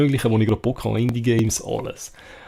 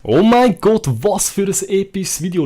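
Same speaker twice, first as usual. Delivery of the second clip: excited; 8 semitones higher, 6 dB louder than usual. Real, not acted. Excited opener to stream viewers.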